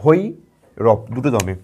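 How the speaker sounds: slow Bhairab in two breaths; two beats. Shown as a demonstration. Teacher showing pupils Bhairab in two slow beats. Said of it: "কটা দমে উচ্চারণ করছি".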